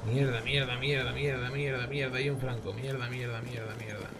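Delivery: muttered string of curses, fading; cursing under breath. Real, not acted. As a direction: mutter curses rapidly, trailing off.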